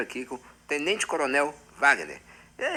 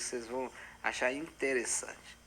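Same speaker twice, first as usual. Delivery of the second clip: ordinary delivery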